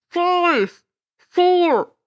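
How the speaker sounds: loud countdown over a PA; booming loudspeaker. Acted. Loudspeaker counting down to the broadcast, booming announcer voice. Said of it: "A loudspeaker voice boomed".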